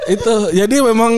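laughing. Real, not acted. Still laughing after joke.

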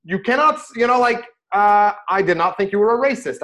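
retelling a loud, high scolding; indignant rabbi scolding his taxi driver, loud and high; telling him off. Retold or imitated. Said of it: "So I tell him off".

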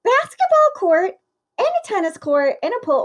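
high, amazed gushing; wide-eyed marvel at the list; awed gush. Retold or imitated. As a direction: gasp it out like you can't believe it. Mimicking awed reaction to rich friends.

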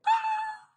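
very high sharp "Ah!" cry; retold cry of joy. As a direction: let out a piercing gasp of relief. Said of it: "I was like".

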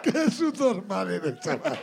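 slow, dragged out through laughter; laughing insult. Real, not acted. Teasing his co-host, words stretched through laughter. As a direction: tease him while still laughing.

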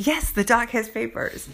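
fed-up echo back at him; a touch of sarcasm in the repeat; exasperated reply. Retold or imitated. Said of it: "I'm like".

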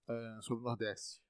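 ordinary talk

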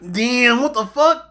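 loud, very high retold exclamation; shocked outburst. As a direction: blurt it out in amazed disbelief, reenacting the moment. Retelling his shocked reaction while watching.

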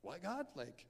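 high, whiny disbelief at God; incredulous complaint. Retold or imitated. Student mocking a grim morning devotional, high incredulous whine. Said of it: "you're like".

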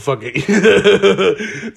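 laughing it off as he talks. Real, not acted. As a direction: chuckle through the words. Laughing at his parents' English.

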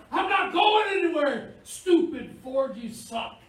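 high, loud mock rant at the car; a driver losing it at a car that won't move; frustrated driver. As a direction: yell at the stupid Ford, hammed up. Driver cursing his stuck car.

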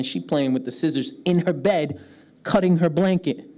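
ordinary delivery

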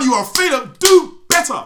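loud, high mock berating; mock harsh scolding. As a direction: yell it like a cruel drill coach. Mock harsh accountability partner.

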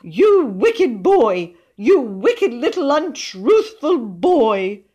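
high, loud, drawn-out rebuke; outraged high-pitched telling-off; indignant scolding. A narrator's reading. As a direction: denounce the child, stretching each word. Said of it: "pausing to glare at William".

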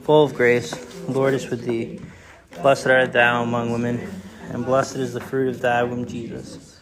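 low, even recited prayer. Real, not acted. Leading the church in the Hail Mary, recited prayer. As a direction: pray it together with the congregation.

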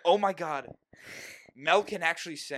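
loud, bright surprise at a discovery; excited announcing. Retold or imitated. Friend announcing to the room that Mel sings, loud and amazed. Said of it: "They're like".

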